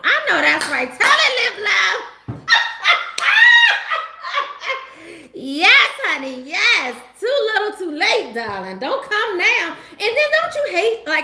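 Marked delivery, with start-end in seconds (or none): 2.5-5.3 loud high cackle. Real, not acted. Cheering a commenter's remark.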